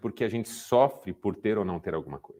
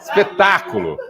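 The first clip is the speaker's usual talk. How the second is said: louder, slow, laughing exclamation; a laughing, drawn-out "Espetáculo!"; delighted; 3 semitones higher, 9 dB louder than usual. Real, not acted. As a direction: savour the happy ending. Joyful punchline of story.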